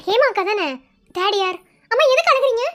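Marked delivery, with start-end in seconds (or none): 1.9-2.6 high, loud worried question; alarmed concern. Acted. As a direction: ask in startled concern. Startled to find senior crying. (பதறி, உயர்ந்த குரலில் கேட்டல்)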